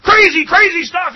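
high, loud hard-sell pitchman; used car salesman voice. Acted. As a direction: bellow it like a TV car ad. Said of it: "those used car salesman voices".

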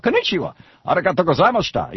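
"konnichiwa" jumped up very high in pitch; "konnichiwa" squeaked high, "arigatou gozaimashita" stays high; high-pitched Japanese. Shown as a demonstration. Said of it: "however you say it, it means the same thing".

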